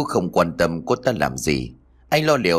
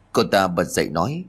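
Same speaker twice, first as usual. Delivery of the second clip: ordinary delivery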